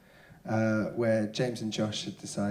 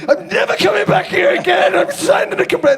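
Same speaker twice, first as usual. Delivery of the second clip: high, loud furious vow; angry heckler shout; 12 dB louder than usual. Retold or imitated. Angry punter vowing never to return. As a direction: yell it as a final threat. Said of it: "he goes".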